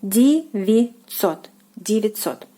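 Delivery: девятьсот said "dee-vee-TSOT", vowels as E and ть as ts. Shown as a demonstration. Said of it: "something like that".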